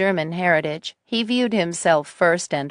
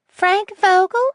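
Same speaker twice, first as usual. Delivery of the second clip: put-on high old lady voice; 5 dB louder, slower than usual. Nettie voiced, anger rising.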